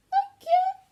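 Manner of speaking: very high, loud squeak; squeaky thanks. Acted. Baby mice squeaking thanks to George, very high and loud. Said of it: "they squeaked".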